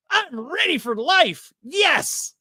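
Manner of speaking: put-on high, slow, overjoyed kid voice; hammed-up cheerful kid. The happiest son wakes up every morning, high, hammed-up joyful kid voice. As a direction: greet the morning like a kid with theme music playing.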